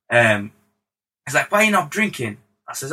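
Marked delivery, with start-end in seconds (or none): 1.5-2.4 high-pitched, badly imitated star. Star asking the producer about drinking, bad impression. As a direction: put on a poor celebrity impression, voice raised. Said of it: "This is a really terrible [name] accent".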